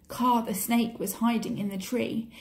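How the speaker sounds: ordinary delivery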